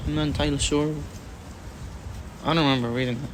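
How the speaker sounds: usual speaking voice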